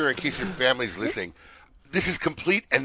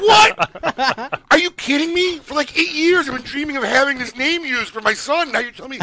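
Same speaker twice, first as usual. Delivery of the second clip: shocked disbelief; 14 semitones higher, 4 dB louder than usual. Retold or imitated. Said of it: "I'm like".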